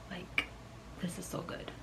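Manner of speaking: very soft, unvoiced amazement; hushed, stunned delight; awed whisper. Real, not acted. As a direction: whisper it, overwhelmed by the scent. Awed after smelling the perfume.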